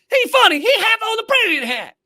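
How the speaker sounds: very high, loud hammed-up Southern accent; thick Southern accent. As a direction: squeal it like an exaggerated Southern yokel. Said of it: "thick Southern accents".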